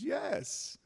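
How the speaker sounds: high, soft yes; universe's voice. Acted. Universe agreeing to self-insults, high soft yes. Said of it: "Universe says".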